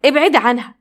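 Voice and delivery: a restrained yell at the nurse; shouting. A narrator's reading. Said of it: "فزعق للممرضة". (بزعيق خفيف في الممرضة)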